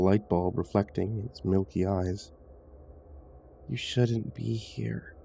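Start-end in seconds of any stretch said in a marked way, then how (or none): 3.7-5.1 put-on soft, very slow, menacing child; snarling ghost child. A narrator's reading. Ghost child warns the intruder away, softly snarling. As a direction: draw out a quiet, eerie warning. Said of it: "the child snarled".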